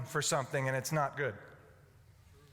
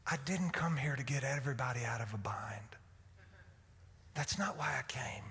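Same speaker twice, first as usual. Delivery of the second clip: low, soft, earnest explanation; gentle imagined Jesus; 6 semitones lower, 7 dB quieter than usual. Acted. Jesus explains to his mom why he came, soft and earnest. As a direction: explain it softly to your mother. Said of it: "Jesus is saying".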